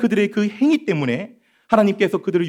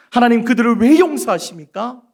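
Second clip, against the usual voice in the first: higher and louder, 왜 pushed up; angrier Jonah; 6 semitones higher, 6 dB louder than usual. Acted. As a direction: escalate the protest to near shout. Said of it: "하나님의 그 사랑 앞에서 화를".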